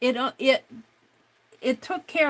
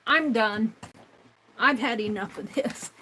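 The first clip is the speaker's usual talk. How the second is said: ordinary talk, nothing marked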